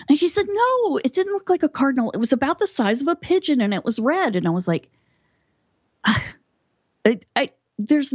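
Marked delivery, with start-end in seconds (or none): 0.5-4.4 much higher, fast, insistent mother; lightly voiced mom, contradicting; imitating mom. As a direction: rattle it off as the stubborn mom. Mother arguing the bird description.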